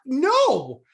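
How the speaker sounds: high false starts, then a loud "no"; stumble, then burst out in refusal; stuttering outrage. Retold or imitated. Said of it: "I'm literally stuttering, typing it out".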